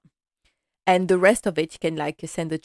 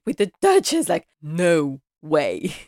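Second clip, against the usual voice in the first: mock indignation; 4 dB louder than usual. Butler refusing to let a singer dine with the Duchess, mock scandalised. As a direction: draw out the refusal, appalled. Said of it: "Carson is like".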